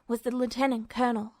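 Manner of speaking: ordinary delivery, nothing marked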